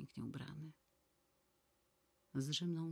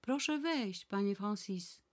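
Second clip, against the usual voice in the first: higher, louder call through the room; 5 semitones higher, 4 dB louder, slower than usual. Acted. A bright loud call to the door. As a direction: Nana calling the hairdresser in from her bed. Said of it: "sama krzyknęła".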